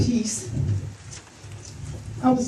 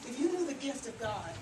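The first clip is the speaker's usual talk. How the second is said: much softer, gentle volume drop; hushed reply; 3 semitones higher, 15 dB quieter than usual. Acted. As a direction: say it very softly, almost to yourself. Acts out the man's solemn reply.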